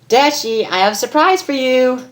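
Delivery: put-on high, bubbly girlish voice; squeaky cheerful Pinkie. Pinkie teasing Rainbow with a surprise, high bubbly voice.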